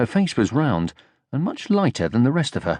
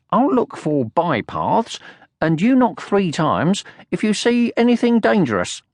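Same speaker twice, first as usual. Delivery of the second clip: bossy leader; 8 semitones higher than usual. A narrator's reading. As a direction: order him about importantly. Sniff giving orders.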